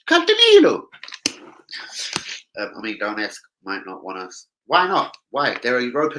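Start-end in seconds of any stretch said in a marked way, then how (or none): 0.1-0.9 much higher, louder mock Irish lilt. Joking about an Irish boy from Ireland, mock Irish. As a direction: sing out the name like an Irish boy. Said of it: "he almost sounds Irish".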